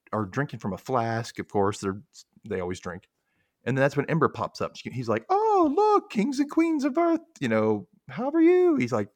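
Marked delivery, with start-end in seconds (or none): 5.3-8.8 very high, loud character voice; hammed-up high-pitched welcome for visiting royals; put-on greeting voice.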